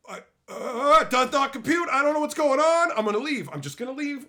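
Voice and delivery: high, loud overloaded outburst; short-circuit in mock despair, voice shooting up; hammed-up confused yelling; acted meltdown. Acted overload at baffling reply.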